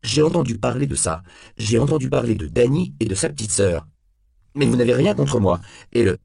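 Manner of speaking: high, urgent protest; cornered suspect pleading his case; panicky backtracking. A narrator's reading.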